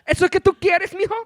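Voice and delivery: high, loud, scolding mom; imitating his mom. Son mimics mom's frantic health lecture, high and loud. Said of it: "And I'm like".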